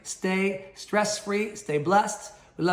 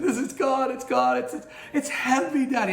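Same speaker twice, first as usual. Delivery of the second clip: high, overcome little boy, imitated; imitation of an emotional kid, pitched way up; retold tearful child; 8 semitones higher, 4 dB louder than usual. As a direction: act out your young son crying in awe. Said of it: "he began to just get cry".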